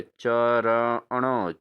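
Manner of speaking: ordinary talk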